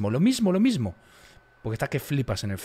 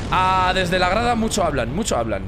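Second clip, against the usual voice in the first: taunting; 5 semitones higher, 5 dB louder than usual. Real, not acted. Taunting chat.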